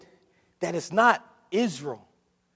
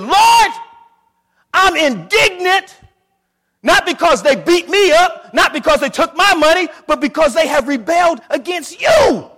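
shouted, much higher and louder prayer; indignant prayer; 11 semitones higher, 15 dB louder than usual. Acted. Believer crying out to God over rebellion, indignant shout. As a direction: cry out to the Lord in outrage. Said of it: "When was the last time you said".

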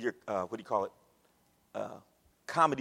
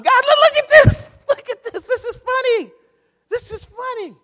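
much higher, amused mocking voice of God; God laughing at them; 13 semitones higher, 7 dB louder than usual. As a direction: play God chuckling at the silly crowd. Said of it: "And it says, God's laughing".

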